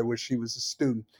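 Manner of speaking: ordinary talk, nothing marked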